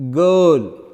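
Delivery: put-on girl, loud, with the er held; long vowel in girl. Shown as a demonstration. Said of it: "with a long vowels".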